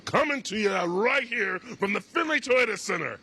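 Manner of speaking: booming, slow, high arena announcer. Pretend. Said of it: "the announcing voice of".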